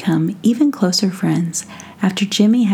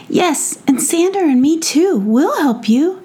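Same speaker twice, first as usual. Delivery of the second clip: put-on much higher, keen little kid; a small child volunteering; eager child voice; 9 semitones higher than usual. Jemmy eager.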